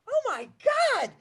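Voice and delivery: high, loud dismayed gasp of a voice; a small creature's horrified cry; dismayed animal. Acted. Storyteller voices a shocked animal, high and loud. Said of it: "Another one said".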